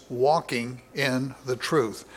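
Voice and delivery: WALKING in the truth, slow and spaced out; stressed phrase. Shown as a demonstration. Preacher stressing the apostle's theme, slow emphasis on "walking in the truth". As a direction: drag out "walking in the truth" word by word. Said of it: "John stresses".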